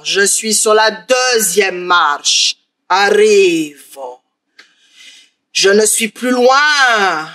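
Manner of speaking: ogre creeping up the stairs, slow and drawn out; slow menacing ogre. Acted. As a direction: drag each word, sinister and patient.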